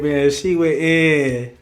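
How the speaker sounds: ordinary delivery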